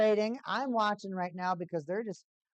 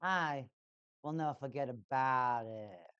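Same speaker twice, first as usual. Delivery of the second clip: put-on Jersey accent; 3 semitones lower, 4 dB quieter than usual. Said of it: "he's got that Joyzy".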